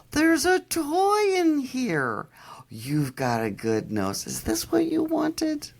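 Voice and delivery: very high, slow sing-song praise; baby talk to a dog. Retold or imitated.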